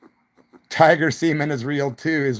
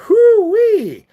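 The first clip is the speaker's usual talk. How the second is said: a loud, high whoo-wee of disbelief; high whooping whoo-wee; 8 dB louder than usual. Real, not acted. Streamer whoops loud and high, recalling the wild night.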